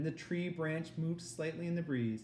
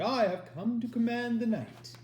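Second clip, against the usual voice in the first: intoning Dark Queen; 11 semitones higher, 7 dB louder than usual. Acted. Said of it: "she intoned".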